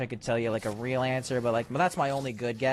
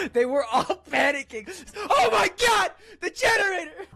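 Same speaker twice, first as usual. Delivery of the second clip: much higher, louder, laughing frantic cries; mock the screaming crowd; hammed-up panic; 6 dB louder than usual. Acts out the panicking characters.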